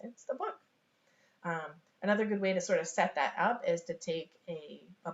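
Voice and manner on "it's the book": high, soft rising discovery; mild wonder. Acted. Parent and child look at fallen book, mild wonder. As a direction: share a small discovery with a toddler.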